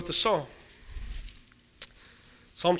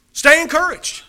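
higher and louder, punch it; louder emphatic exhortation; 6 semitones higher, 4 dB louder than usual. Acted. Preacher pressing the congregation, loud and emphatic. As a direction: drive it home with force.

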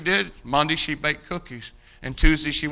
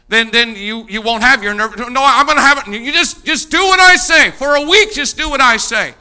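loud, higher insistence; insistent pastor; 6 semitones higher, 8 dB louder than usual. Retold or imitated. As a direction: press her hard to obey.

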